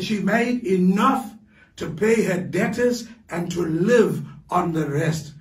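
ordinary delivery

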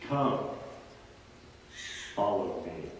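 a hint of a tender Jesus; gentle call. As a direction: call him softly to follow. Jesus calls Peter.

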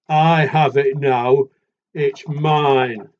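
loud and slow, firm; big animal voice. Acted. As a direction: boom the claim like the big one. Big animal claims the chair.

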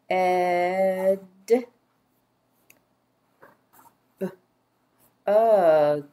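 "B-ed" and "B-ug" broken into drawn-out sounds; lone b before a stretched "ed" and "ug"; sounded out. Shown as a demonstration. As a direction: check each sound of "B-ed", "B-ug" with long pauses.

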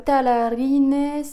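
slow, loud, clumsy Spanish accent; thick French-accented Spanish. Real, not acted. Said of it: "excusez-moi pour mon accent espagnol". (accent espagnol à couper au couteau)

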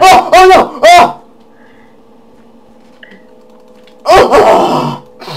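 high, loud startled cries of alarm; panicked yelps. Real, not acted. Gamer yelping at a sudden attack in the game. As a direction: yelp as the enemy gets you.

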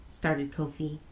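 plain reading voice

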